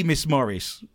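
ordinary delivery

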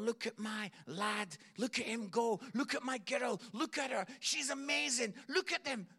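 put-on God beaming over his children, rising up; proud cheering. God showing the angels his children, growing excitement. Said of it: "he's going".

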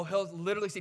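ordinary talk, nothing marked

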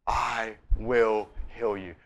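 gently drawn-out assurance; hushed, deliberate vow; slow, soft promise. Retold or imitated. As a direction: give each word quiet weight. Voicing Jesus's promise.

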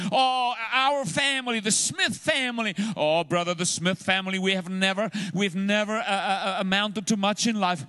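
high, loud moaning complaint; whiny complaining voice. Retold or imitated. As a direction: whine about your family. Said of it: "and we say".

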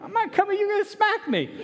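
put-on very high, laughing childish voice; scared kid. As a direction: squeal like a kid afraid of a smack. Boy answering his dad.

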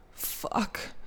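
whispered, drawn-out curse; very soft, voiceless exclamation; breathy moan. A narrator's reading. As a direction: let it out on a long soft breath. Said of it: "Ze kreunt het uit".

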